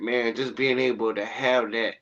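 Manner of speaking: ordinary delivery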